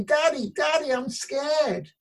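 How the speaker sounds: put-on high, frightened child calling for his daddy; scared little boy. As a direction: cry out to daddy like a small boy in the dark. Child calls out in thunderstorm.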